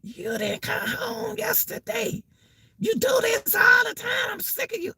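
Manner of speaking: very high, thin-volume mock nagging; voice way up here. Wife complaining to her husband, voice way up high. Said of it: "you hear".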